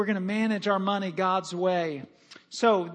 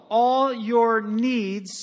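"all" stressed, very slow and louder; with emphasis; 2 semitones higher, 5 dB louder, much slower than usual. Shown as a demonstration. Pastor to congregation, slow louder stress on "all". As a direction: stretch out "all your needs" and stress "all". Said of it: "read it with some emphasis".

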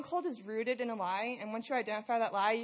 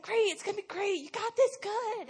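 much higher, bubbly cheerleader voice; imitating an upbeat friend, pitched way up; Lisa's cheerful encouragement; 11 semitones higher, 4 dB louder than usual. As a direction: hype her up like an eager coach. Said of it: "she's like".

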